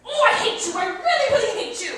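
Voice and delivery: high, loud shriek of frustration; furious outburst. Acted. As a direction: scream it at your spouse. Wife yelling hatred at husband.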